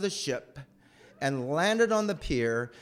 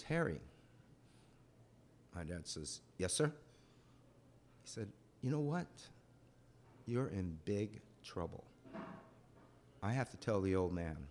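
stern quiet officer; 7 semitones lower, 13 dB quieter than usual. Retold or imitated. CO scolds dad, solemn scene.

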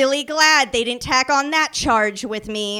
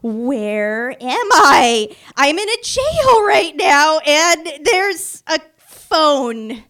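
drawn-out, higher and louder bewilderment; confused wonder; 4 semitones higher, 6 dB louder, much slower than usual. Retold or imitated. Drunk woman waking in jail, slow and bewildered. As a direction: wake up lost and amazed. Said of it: "And I was like".

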